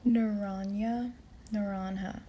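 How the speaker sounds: naranja said slowly, na-RAN-ja with a Spanish j; careful teacher repeat of naranja; language lesson. Shown as a demonstration. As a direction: sound out naranja for a learner. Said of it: "a short but sweet language lesson".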